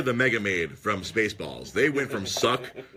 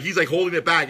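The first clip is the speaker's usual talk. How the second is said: ordinary talk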